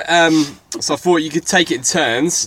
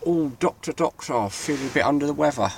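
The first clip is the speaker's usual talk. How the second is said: sickly patient; 2 semitones higher, 6 dB quieter than usual. Pretend. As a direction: moan it like a poorly patient. Patient line for doctor game.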